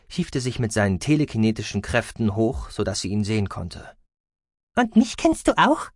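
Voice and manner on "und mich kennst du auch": high little creature voice; high-pitched cartoon-animal questioner; mouse-beaver voice. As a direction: ask eagerly in a squeaky critter voice. Mouse-beaver Guki speaks to patient.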